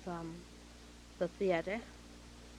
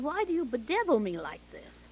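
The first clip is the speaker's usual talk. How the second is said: loud, high exasperated question; retold exasperation; 6 semitones higher, 6 dB louder than usual. Director feeling bedeviled by actress, exasperated. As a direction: demand it as if at wit's end.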